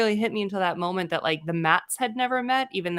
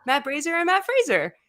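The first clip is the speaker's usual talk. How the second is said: high, bright greeting voice; a teasing high-pitched call-out; pitched up playful impression; mock excited; 10 semitones higher than usual. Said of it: "he's like".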